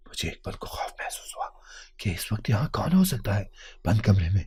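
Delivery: hushed, whispered narration; very soft, apprehensive whisper; fearful whisper. A narrator's reading. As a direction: whisper it as if afraid of being heard. Narrator frightened outside a closed room.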